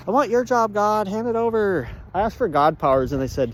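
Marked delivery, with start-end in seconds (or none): 0.1-1.9 high-pitched, pushy acted plea; mock demand. Host asking God for his job, high mock demand. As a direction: press the demand in a high, insistent voice.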